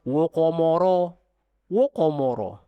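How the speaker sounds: "waqamara" with every vowel rounded, like wo-ko-mo-ro; all thick, wrong. Shown as a demonstration. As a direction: make all of "waqamara" heavy o sounds. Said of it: "Jangan waqamara".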